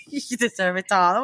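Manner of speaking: half-hearted British AI voice; lightly faked British flattery; bad British accent. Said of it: "I can't do British, uh, accent".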